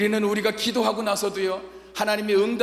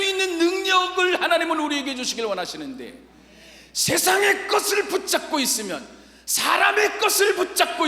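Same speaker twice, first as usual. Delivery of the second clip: full force preaching; 7 semitones higher, 4 dB louder than usual. Acted. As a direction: preach it out over the music.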